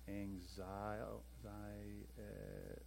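slow, soft, drawn-out syllables; absent-minded sounding-out. Real, not acted. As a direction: say it slowly as if writing it down. Sounding out word while writing it.

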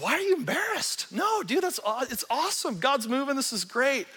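high, surprised protest; amazed reassurance to the embarrassed pastor; incredulous. Retold or imitated. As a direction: jump up high, almost disbelieving. Retelling his eager reply to pastor.

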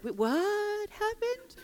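just woken from anesthesia, lost; mock groggy post-surgery voice; groggy confusion. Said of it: "I was so fuzzy".

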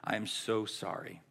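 soft, low apology with understated sorrow; retold tearful apology. Owner apologizing to the coach she betrayed, subdued and sorrowful. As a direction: apologize quietly, only a touch tearful. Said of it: "And she begins crying".

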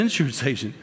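normal talking voice

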